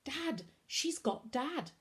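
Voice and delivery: slow, high, tearful cry. A narrator's reading. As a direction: break the news through tears. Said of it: "His shoulders started shaking".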